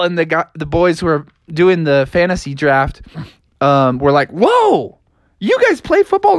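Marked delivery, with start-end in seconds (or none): 4.3-6.4 high-pitched amazed question; wowed, voice leaping up; astonished. Acted. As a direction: gape aloud at the surprise guests. Boys astonished at arrivals.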